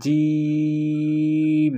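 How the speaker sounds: জিম held long and pressed hard; hard Jeem. Shown as a demonstration. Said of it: "একটু জোর দিয়ে".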